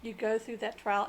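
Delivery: normal talking voice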